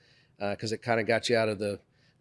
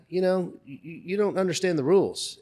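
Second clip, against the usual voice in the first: mildly agitated retold guard, higher pitched; getting worked up; 8 semitones higher than usual. Guard lecturing guest on the rules, mildly worked up. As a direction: imitate a stammering, worked-up guard. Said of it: "he was getting worked up".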